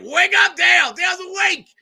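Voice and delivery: high, loud mock announcement; excited shout. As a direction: yell it like a hype man waking the crowd. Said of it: "Craig's like".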